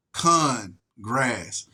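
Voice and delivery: "con grass" split into two words, con stressed; wordplay split. Shown as a demonstration. Ranting to viewers about Congress, splitting it into "con grass". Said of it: "they talking about even the grass is con".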